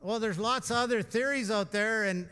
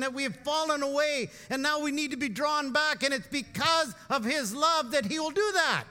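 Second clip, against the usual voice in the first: higher, fervent preaching voice; 5 semitones higher than usual. Acted. Pastor to congregation, preaching God's love with fervour. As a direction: lift it up and preach to the room.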